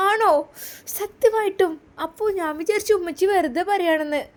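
put-on a small child lighting up with surprise; excited little girl. Daughter hearing they will go to her father, excited childlike.

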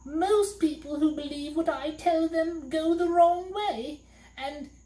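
a high weepy little demon; crying. A narrator's reading. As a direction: wail it out and flee. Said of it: "crying hysterically".

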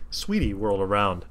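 plain reading voice

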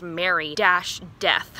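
slow "Mary dash Death", each part spaced out; Mary Death spelled out. Shown as a demonstration. Reviewer spelling the joke name slowly for viewers, "Mary dash Death". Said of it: "it's spelled like Merry Christmas".

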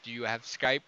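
a lightly voiced roommate mumbling in the dark; eerie mumble from the dark. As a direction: drop low and quiet like a voice out of the dark. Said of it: "all you hear is".

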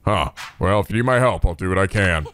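low, steady promise; calm hero. Acted. Kiryu promises to help Tomoko, low and steady. Kiryu repeats his offer.

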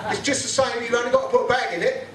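high, mocking hipster voice; prissy mock whine. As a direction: sneer it like a smug fruit-tea drinker. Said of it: "They say".

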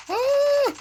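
sudden high-pitched scream of shock; startled shriek. Real, not acted. Gamer shrieks in shock at a sudden attack, startled scream. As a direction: shriek as something jumps you.

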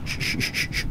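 soft whispered shushing. Real, not acted. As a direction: hush it quietly, over and over. Hushing while hiding.

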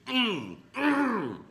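high, loud humming moan of pleasure; delighted high mm. Real, not acted. Host tasting his burger, high pleased humming. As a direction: let the taste pull a high mm out of you.

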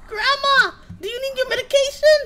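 high, loud startled yelling; real frightened shouting. Real, not acted. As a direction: yell at grandma after the scare. Jump scare reaction.

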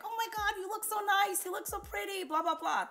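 high, gushing delight over the outfit; gushing compliment. Retold or imitated. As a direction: pitch it way up, all sparkle. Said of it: "she's like".

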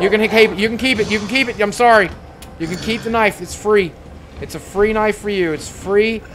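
high loud desperate bargaining; frantic loud begging; panicked pleading. Real, not acted. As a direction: plead for your life.

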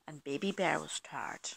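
hushed, sleepy whisper. A narrator's reading. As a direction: breathe it out softly. Sleepy bedtime story hush.

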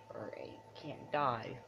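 ordinary talk

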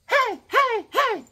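very high, squeaky hey hey; a high cartoon voice calling hey.

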